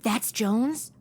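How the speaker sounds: whispered question through the wall. Acted. Madison whispering to Batman while eavesdropping on the villains. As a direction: hush it so the men next door can't hear.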